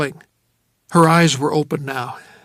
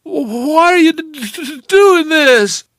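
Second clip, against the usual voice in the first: high, loud, stuttering cry; freezing woman, teeth chattering; 12 semitones higher, 7 dB louder, slower than usual. Acted. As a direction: chatter through the words. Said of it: "Her teeth were chattering".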